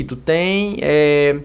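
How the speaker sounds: ordinary talk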